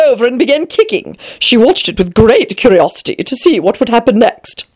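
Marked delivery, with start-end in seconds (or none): none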